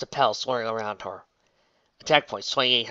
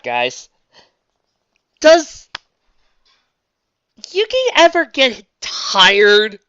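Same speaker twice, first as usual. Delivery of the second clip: high, loud incredulous musing; excited rant; 10 semitones higher, 9 dB louder than usual. Real, not acted. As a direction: marvel at the absurdity.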